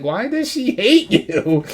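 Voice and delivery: high, slow quizzical tone; mock puzzled voice. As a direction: ask it half-laughing. Mocking self voice.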